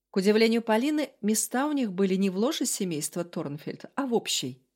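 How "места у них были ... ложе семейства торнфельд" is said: ordinary delivery, nothing marked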